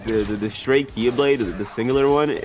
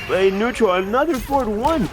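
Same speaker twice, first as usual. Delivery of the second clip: excited; 11 semitones higher, 3 dB louder than usual. Real, not acted. Caster exclaims high.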